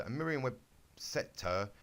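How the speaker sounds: ordinary delivery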